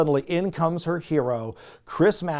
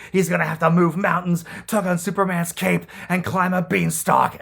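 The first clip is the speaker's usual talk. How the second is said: hammed-up courtroom speech. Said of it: "goes all out ... he's got the emotion".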